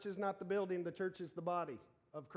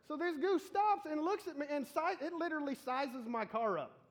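mock alarm; 9 semitones higher, 4 dB louder than usual. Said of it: "goose stare story".

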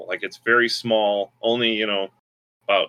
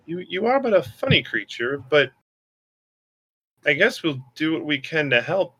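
higher, puzzled concerned voice; concerned Katham; 5 semitones higher than usual. Acted. Katham questions the squirrel spirit, concerned and higher. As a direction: question the odd creature with concern. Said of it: "looks at it very concerned and goes".

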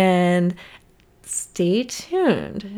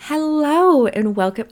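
louder higher intro hello; intro take; 6 semitones higher, 6 dB louder than usual. Shown as a demonstration. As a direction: try the intro again, projected. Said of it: "why do I hate all of my hellos".

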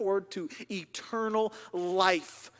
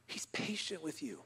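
soft near-whispered repeat, gently slowing on patient; hushed; 4 semitones lower, 10 dB quieter than usual. Real, not acted. Preacher lets the verse sink in for the congregation, hushed. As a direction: drop to a tender hush.

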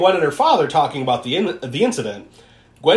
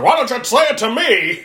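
mock angry challenge; 7 semitones higher, 3 dB louder than usual. Larry slams door, confronts the women.

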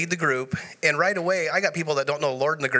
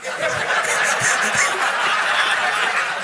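burst of laughter; 7 semitones higher, 4 dB louder than usual. Real, not acted. Joke about apologetics.

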